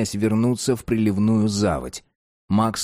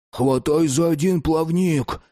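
put-on urgent boy voice; 7 semitones higher, slower than usual. Max urging Newton.

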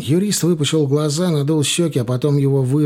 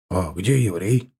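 lower, slow grumbling thug; the thug with the knuckleduster grumbles it, lower and slow; grumbled; 6 semitones lower than usual. A narrator's reading. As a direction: grumble it low and sullen. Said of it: "буркнул мужик со свинчаткой".

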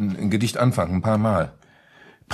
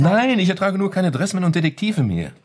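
much higher, fed-up outburst; husband snapping back at his wife, exasperated and much higher; 10 semitones higher than usual. Acted. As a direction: throw up your hands and shout it out. Husband snaps back in quarrel.

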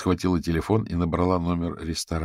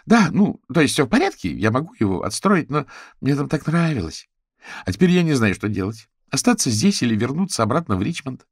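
high, plaintive fretting; distressed, high-pitched and unsure; upset, sniffly; 8 semitones higher, 6 dB louder than usual. A narrator's reading. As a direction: put on a brave face, then waver. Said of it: "Битси шмыгнула носом".

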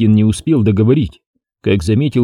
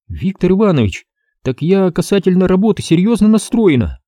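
put-on girl's voice, higher, insisting; earnest girl; 8 semitones higher than usual. A narrator's reading. Girl tells her boss she is serious, higher and earnest.

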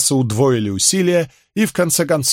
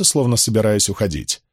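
usual speaking voice

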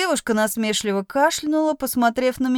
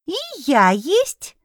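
small high creature voice, slow; squeaky little kitten; 6 semitones higher, slower than usual. A narrator's reading. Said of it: "раздалось над головой".

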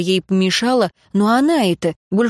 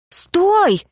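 high, sudden cry to halt; cried out; 11 semitones higher than usual. A narrator's reading. Girl trying to stop her attacker, crying out high. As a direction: call out sharply with pitch leaping up. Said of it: "Я крикнула".

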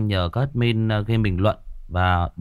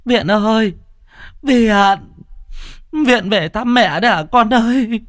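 mother crying out to her son's ghost, wailing; calling out wailing; 12 semitones higher than usual. A narrator's reading. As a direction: call into the night for her lost child. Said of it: "ngó qua đầu nhà mà gọi".

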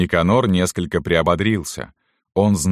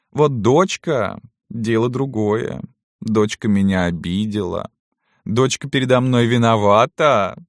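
feigned-kindly mistress; 6 semitones higher than usual. A narrator's reading. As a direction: croon high at the old servant, stretching the words. (притворно ласковая барыня, высоко и протяжно)

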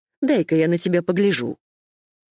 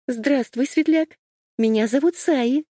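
put-on excited girl voice; 8 semitones higher than usual. Girl eagerly greets the firefly.

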